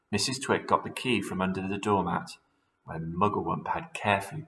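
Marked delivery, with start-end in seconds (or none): none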